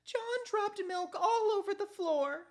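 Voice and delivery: high, loud call from the kitchen; mom calling out. Acted.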